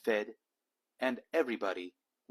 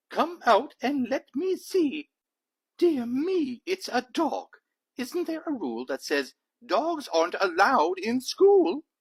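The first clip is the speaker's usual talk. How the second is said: put-on high, louder fussy old trustee voice; startled trustee; 12 semitones higher, 8 dB louder than usual. Trustee discovering the dog at inspection, high and fussy. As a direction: play a flustered old trustee peering out.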